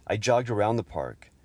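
ordinary talk, nothing marked

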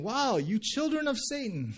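high-pitched acted outburst; mock yelling. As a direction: cry it out like an indignant prophet. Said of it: "he yells out, basically saying".

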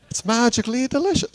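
high, put-on Irish lilt in a cereal-ad tone; a hammed-up Irish leprechaun voice; bad Irish accent. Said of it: "not gonna pretend to do an Irish voice".

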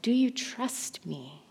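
slow, very soft question; Jesus. Retold or imitated. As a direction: ask gently, giving each word time.

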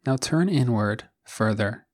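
calm, even meditation voice with long pauses; guided meditation. Acted. Host guiding listeners to settle into the body, calm guided meditation. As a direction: guide them gently into stillness, one phrase at a time.

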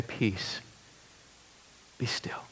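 barely whispered, calm; whispered command. Retold or imitated. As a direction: hush the storm in a whisper. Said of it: "stand with a word and say".

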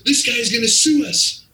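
high-pitched mock panic; alarmed retelling; panicked. Club owner fears a lawsuit.

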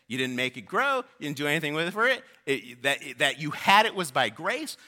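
rising, fervent voice of God questioning Jonah; preaching God's rebuke. Acted. Preacher voicing God rebuking Jonah: fervent, rising preaching. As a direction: press each question home like a stern father.

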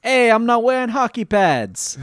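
loud, high New York tough guy; brash Brooklyn street talk; New York accent. Acted. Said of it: "say hockey pads in a New York accent".

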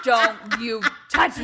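slow, loud hammed-up warning; mock threat. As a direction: growl a fake threat at him. Mock threat at husband waking her.

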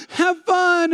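fake-nice comment. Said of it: "You go".

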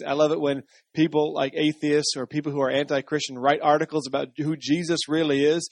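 ordinary delivery

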